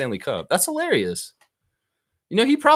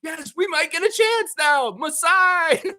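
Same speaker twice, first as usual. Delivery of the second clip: higher, hopeful mock fan voice; a Leaf fan daring to hope; lightly voiced fan, hopeful; slower than usual. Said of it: "they might be like".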